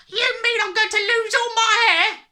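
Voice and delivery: very high, wailing yell. A narrator's reading. Husband fearing for his hair, yelling. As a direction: yell it in panic. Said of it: "the husband yelled".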